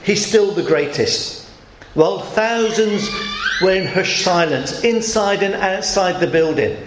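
ordinary delivery

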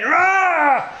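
high, drawn-out roar; lion's roar. Acted. Prideful lion roars at a monkey, roaring. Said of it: "he roars again".

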